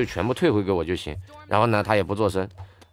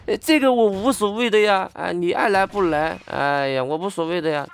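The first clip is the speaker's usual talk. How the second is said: much higher, airy, couldn't-care-less Mandarin; mock Mandarin shrug-off; 10 semitones higher than usual. Shop owner brushing off the customer, mock high Mandarin shrug. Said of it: "他就甩了个普通话跟我说".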